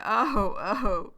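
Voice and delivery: sympathetic reaction, re-enacted; showing emotion. Shown as a demonstration. Teacher re-enacting her reaction to Joe's story, showing emotion. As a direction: react with a drawn "oh". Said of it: "I'm just showing emotion".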